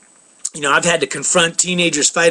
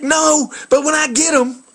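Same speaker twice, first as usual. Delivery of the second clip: high mock shout of a threat; imitated shout; 8 semitones higher than usual. Stranger shouting his threat at the dog walker, high mock shout. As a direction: shout it like a hothead out for revenge. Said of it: "he's like".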